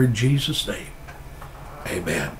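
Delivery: normal talking voice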